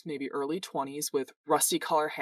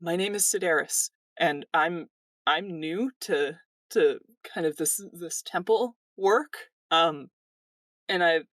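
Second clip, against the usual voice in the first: put-on higher, hesitant, awestruck young man; earnest young temple worker; 5 semitones higher than usual. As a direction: stumble shyly through heartfelt thanks. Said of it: "He says".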